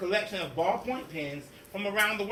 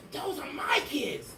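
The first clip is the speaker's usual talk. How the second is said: scratchy voice; 6 semitones higher than usual. Acted. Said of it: "she said in a scratchy voice".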